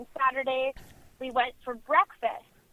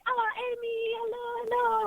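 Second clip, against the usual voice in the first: much higher, softer friendly French greeting; 10 semitones higher, 5 dB quieter than usual. Retold or imitated. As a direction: sing out a warm French hello. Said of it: "all the French people were like".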